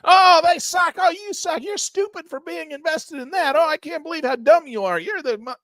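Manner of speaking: imitating forum users yelling insults at him; mock attacker. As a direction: spit out the insults like a sneering troll. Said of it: "And I get attacked".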